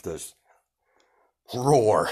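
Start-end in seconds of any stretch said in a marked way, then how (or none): 1.5-2.1 loud, raised roar on the word; mock massive roar. Echoing the halls, a mock beast roar. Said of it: "A massive".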